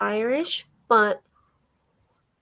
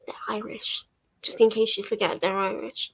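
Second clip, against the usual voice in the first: mock whispered aside; whispered sarcastic reminder; 5 dB quieter than usual. Real, not acted. Mocking the book's heavy Irishness.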